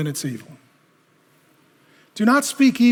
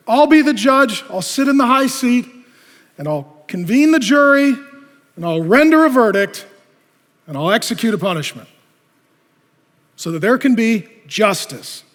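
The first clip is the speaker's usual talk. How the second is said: proud self-appointed judge; 4 semitones higher, 6 dB louder than usual. Acted. Preacher mocking a proud judge, loud and pompous. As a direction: puff yourself up as the judge. Proud person takes high seat.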